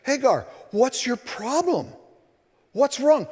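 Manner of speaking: edged, prodding question; with an edge. Shown as a demonstration. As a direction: ask it with a slight sharp edge, not sarcastic. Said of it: "it has an edge on it".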